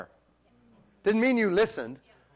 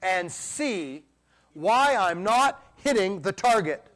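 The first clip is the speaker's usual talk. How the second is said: a light pleading voice for David, higher and slow; David's plea; 6 semitones higher than usual. Retold or imitated. Preacher voicing David's searching plea, lightly pleading and high. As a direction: ask earnestly like an archer seeking help. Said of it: "like David said".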